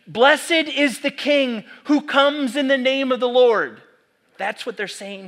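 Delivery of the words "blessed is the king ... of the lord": raised, louder proclaiming of a crowd hailing a king; crowd's joyful acclaim. Retold or imitated. Preacher voicing the crowd welcoming Jesus, joyful and loud. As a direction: call it out like the cheering crowd.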